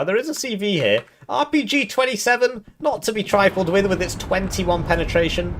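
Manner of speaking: high, excited caster voice calling the action; live play-by-play. Acted. Caster narrating the match to viewers, excited play-by-play. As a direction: call every move like a hyped commentator.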